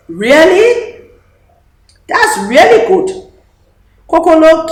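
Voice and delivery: Mama thrilled to hear the news; delighted surprise. A narrator's reading. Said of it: "Mama smiled".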